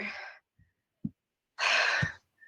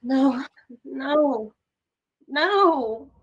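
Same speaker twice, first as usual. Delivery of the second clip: high, loud cries of no, easing off; repeated pleading no, pitched high; distressed; 13 semitones higher, 15 dB louder, much slower than usual. Acted. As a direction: cry out in distress. Sasha distressed alone in room.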